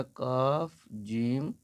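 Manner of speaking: very slow, کاف and جیم each stretched; letter names drawn out. Shown as a demonstration. Teacher slowly spelling letters for a student. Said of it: "کاف اور جیم کو ملا کر کے لکھیں گے".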